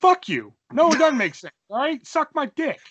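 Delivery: high, loud, indignant rebuff; mock outrage. Storyteller to co-host siding with dad, mock outrage. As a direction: snap back in playful offence. Rebuts co-host saying it makes sense.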